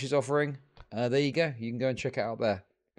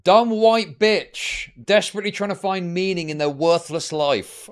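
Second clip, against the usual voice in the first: loud, higher scornful jeer; mocking rant; 4 semitones higher, 6 dB louder than usual. Real, not acted. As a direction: sneer it out loud and high at the clip. Mocking streamer he reacts to.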